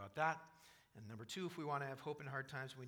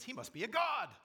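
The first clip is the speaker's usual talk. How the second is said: much higher and louder, amazed crowd; awed exclamation; 8 semitones higher, 8 dB louder than usual. Retold or imitated. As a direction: cry it out in astonishment. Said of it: "the very next thing the people say is".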